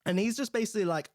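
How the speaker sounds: ordinary talk, nothing marked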